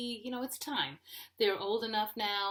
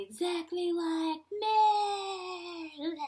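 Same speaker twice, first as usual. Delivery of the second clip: high, slow, escalating wail; mock furious screech; 10 semitones higher, 6 dB louder, much slower than usual. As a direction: stretch the last word into a long loud shriek. Acted threat to husband, ending in screech.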